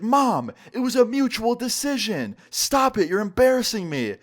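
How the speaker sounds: high-pitched, exasperated mock yell; retold yell. Ex yelling at her mom to stop, exasperated. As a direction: imitate a daughter yelling, pitch way up. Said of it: "my ex yells at her".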